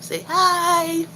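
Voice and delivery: put-on high sing-song voice for the baby; high cooing baby talk. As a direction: coo at the little one to say hi. Voicing for baby.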